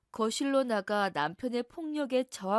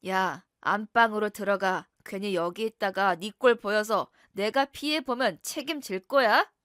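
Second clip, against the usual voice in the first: menacing husband; 6 dB louder than usual. Acted. Husband hushes wife, police outside.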